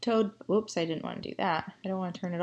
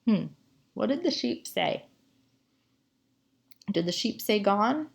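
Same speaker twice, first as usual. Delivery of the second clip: ordinary talk, nothing marked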